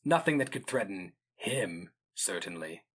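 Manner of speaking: usual speaking voice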